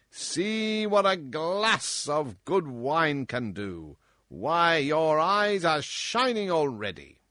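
loud, high, bullying king voice; roaring tyrant king. Acted. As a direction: boom like a spoiled drunken tyrant. King mocks the dwarf.

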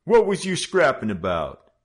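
high, loud hoodlum demand. Acted. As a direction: force an answer out of him. Gang leader questions Joe.

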